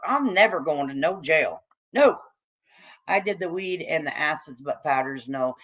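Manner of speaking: normal talking voice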